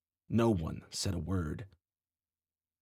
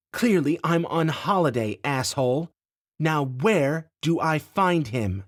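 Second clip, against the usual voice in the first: angry demand; 7 semitones higher, 5 dB louder than usual. A narrator's reading. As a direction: bite off each word at him. Heroine after punching him.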